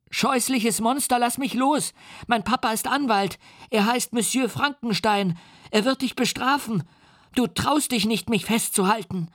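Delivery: put-on very high, defiant child's shriek; a frightened boy threatens with his father; screaming child. A narrator's reading. The boy shrieks his father's name at the monster. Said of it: "schrie er".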